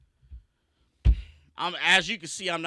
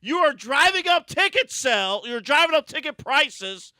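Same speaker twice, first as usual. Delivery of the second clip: loud, raised ranting; angry rant; 6 semitones higher, 5 dB louder than usual. Real, not acted. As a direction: vent the outrage. Heated rant continues.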